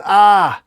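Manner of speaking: loud, high cry of pain; hero's hammed-up yell as a blow lands. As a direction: cry out loudly. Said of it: "Superman yells".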